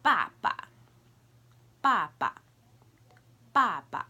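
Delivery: "Ba ba": forceful falling first, light staccato second; first "Ba" falls hard, second clipped. Shown as a demonstration. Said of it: "So it sounds like this".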